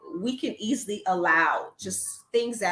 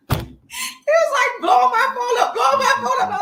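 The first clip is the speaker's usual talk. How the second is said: high laughing imitation of him; higher and louder through laughter; giggling while quoting him; laughing retelling; 8 dB louder than usual. Retelling the frantic calls.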